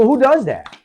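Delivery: high, incredulous retold challenge; exasperated mock outrage; indignant disbelief. Retold confrontation with the other hunter.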